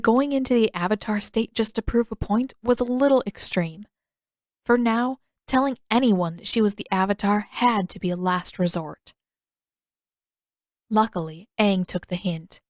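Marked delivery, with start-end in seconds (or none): none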